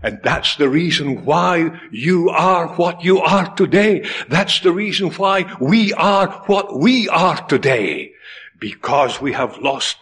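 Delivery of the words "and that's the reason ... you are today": ordinary talk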